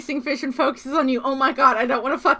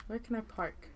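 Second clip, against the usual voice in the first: much softer, mumbled to yourself; muttering; 16 dB quieter than usual. Real, not acted. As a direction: ask it under your breath. Mutters same question, then pleads aloud.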